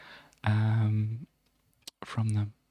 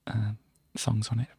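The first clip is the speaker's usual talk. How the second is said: ordinary talk, nothing marked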